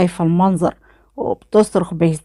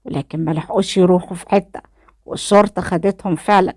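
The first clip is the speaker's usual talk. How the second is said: ordinary delivery, nothing marked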